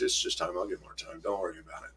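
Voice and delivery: dad dodging his daughter's question, quietly. Retold or imitated. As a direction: trail off, shrugging it away quietly. Dismissing daughter, ashamed.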